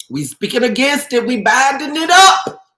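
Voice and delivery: rising high and loud, preacher-like; fervent prayer declaration. Real, not acted. Host praying against a viewer's illness, fervent declaration. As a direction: declare it with force, building to the end.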